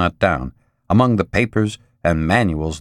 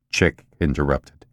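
plain reading voice

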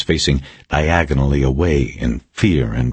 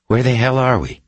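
high-pitched, insistent question; insistent demand; 9 semitones higher than usual. A narrator's reading. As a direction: press the captor for an answer. Captive repeats her demand.

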